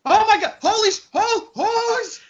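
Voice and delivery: very high, squealed outburst; shrieking freak-out. Acted. Player reacts as newly transformed kobold.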